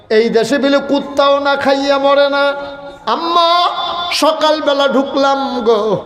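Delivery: high, chanted wail to mother; crying child's plea. Acted. Hungry child complaining to mother, high acted wailing plea. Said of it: "বাচ্চা দুইটা কান্দে".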